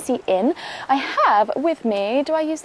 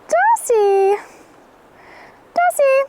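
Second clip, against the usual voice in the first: high, loud call across a field; 13 semitones higher, 7 dB louder, much slower than usual. Real, not acted. Owner calls Darcy in the field, calling out. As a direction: call the horse from far off.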